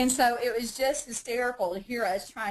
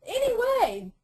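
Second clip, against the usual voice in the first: high silly voice; 12 semitones higher, 3 dB louder than usual. Pretend. Continues the silly voice.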